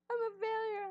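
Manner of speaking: high fake wail; mock crying. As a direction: whimper it like a fake sob. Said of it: "I'm just kidding".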